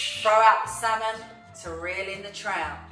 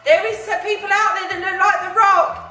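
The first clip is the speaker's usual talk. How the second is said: excited; 10 semitones higher, 11 dB louder than usual. Real, not acted. Excited about crush.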